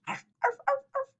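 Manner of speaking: squeaky high arfs; high puppy barking; high puppy yips. Acted. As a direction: yap like a tiny puppy. Puppy barks.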